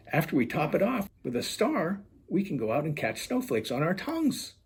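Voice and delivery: high bright-voiced Mistletoe; sweet high character voice; cheerful Mistletoe. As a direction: chirp it as the eager friend.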